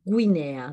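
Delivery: « Guinea » dit goui-nè-a; U prononcé. Shown as a demonstration. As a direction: faire sonner le U de « Guinea ». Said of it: "Même chose pour". (« Guinea » avec toutes les lettres, gou-inea)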